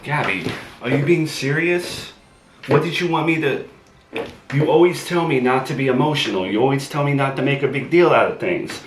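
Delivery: high, loud, fast frustrated yelling; exasperated shouting. Acted. Boyfriend yelling at Gabby after she tells him to leave, loud and fast. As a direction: shout him down, fed up and defensive.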